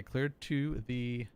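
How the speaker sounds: cleared to the stretched out slowly; slow model clearance read; slow demo clearance. Shown as a demonstration. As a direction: read the phrase very deliberately.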